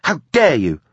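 high-pitched, affronted outburst; outraged. Acted. Granddaughter accused of leaking, outraged. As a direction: throw it back in outrage. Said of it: "high-energy outrage".

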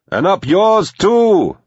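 loud, high, drawn-out shout; yelled retort. A narrator's reading. Teddy answering the manor voice rudely, yelling. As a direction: yell it back slowly across water.

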